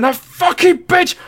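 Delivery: high, slow, imitated fury; drunk rage. Drunk friend raging about his girlfriend on the walk home. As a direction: spit it out like a drunk furious man. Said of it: "starts kicking fences".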